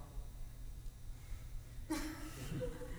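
high hissing whistle like a boiling tea kettle. Acted. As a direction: whistle steam out like a kettle on the boil. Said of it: "The clown does an impression of a tea kettle".

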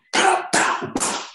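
sharp mouth-made slap noises; imitated slap sounds. Retelling director slapping actor, imitated smacks. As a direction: voice the smacks as percussive pows. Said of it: "slaps the shit out of me".

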